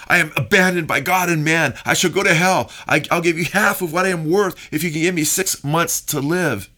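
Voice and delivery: a touch of desperate despair; desperate cry. Retold or imitated. Preacher acting out Voltaire's final plea, mildly desperate. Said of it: "He cried out".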